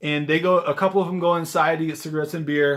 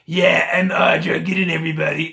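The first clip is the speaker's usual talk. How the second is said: smoker's voice; 4 dB louder than usual. Retold or imitated. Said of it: "smoker's breath".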